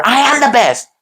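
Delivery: high-pitched pumped-up self-praise; exaggerated rah-rah boast; mock affirmation. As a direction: proclaim it like a hollow affirmation. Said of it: "Affirmations are".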